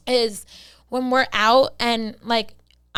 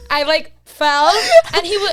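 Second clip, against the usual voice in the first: ordinary delivery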